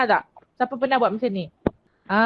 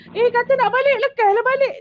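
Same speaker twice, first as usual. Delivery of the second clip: much higher, louder impatient shooing; rude impatient host; 11 semitones higher, 4 dB louder than usual. Retold or imitated. Teacher mimicking rude host hurrying guest out, impatient. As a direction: shoo the guest off, loud and annoyed. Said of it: "kita janganlah macam".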